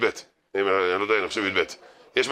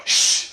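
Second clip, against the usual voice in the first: sharp imitated shush; mimicked "shhh"; 4 dB louder than usual. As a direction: hush someone praying too loudly. Imitates someone shushing from behind.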